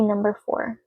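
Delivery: ordinary talk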